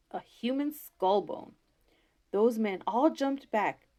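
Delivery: usual speaking voice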